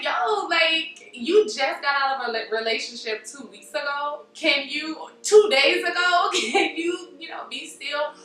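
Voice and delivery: half-laughing, exasperated plea; mock exasperation. Voicing talk to a serial dater.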